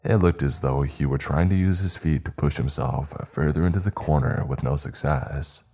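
usual speaking voice

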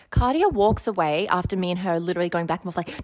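ordinary talk

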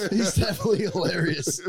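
laughing. Real, not acted. Repeated line, first take laughing.

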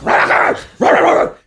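barking rah; mock Rottweiler bark; dog growl imitation. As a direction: snarl like a chained dog. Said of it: "They're like".

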